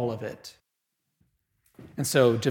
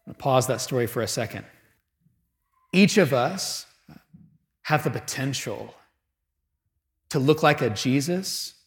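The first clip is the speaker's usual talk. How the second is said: ordinary talk, nothing marked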